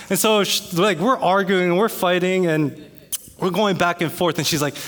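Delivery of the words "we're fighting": normal talking voice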